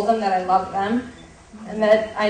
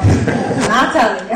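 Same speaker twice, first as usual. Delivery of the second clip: slow, teasing refusal through a laugh; 8 dB louder, slower than usual. Retold or imitated. Girl teasing her parents, laughing refusal. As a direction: drag it out with a laugh.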